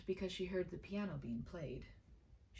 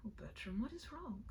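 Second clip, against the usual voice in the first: a near-sob, the question rising high and whispered; almost weeping; 6 semitones higher than usual. Acted. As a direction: plead with him, on the edge of tears. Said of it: "She almost wept the words".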